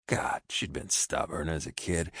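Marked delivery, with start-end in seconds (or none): none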